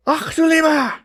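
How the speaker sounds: put-on high, loud barking German accent; barked German accent. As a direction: bark it out with a thick German accent. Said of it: "she barked tyrannically".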